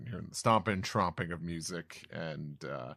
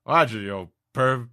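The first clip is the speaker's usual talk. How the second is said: louder, higher teasing giant; 6 semitones higher, 12 dB louder than usual. Acted. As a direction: call out cheekily to the woman. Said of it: "so it's like".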